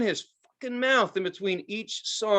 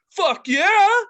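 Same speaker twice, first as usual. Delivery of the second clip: much higher, louder crowd-pleasing yell; shouted fuck yeah; 14 semitones higher, 9 dB louder than usual. Retold or imitated. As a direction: belt it out like a rock star on stage. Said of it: "You say".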